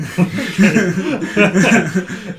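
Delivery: loud, high burst of laughter; gleeful laugh. Real, not acted. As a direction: laugh out loud, delighted to be right. Laughing at being proven right.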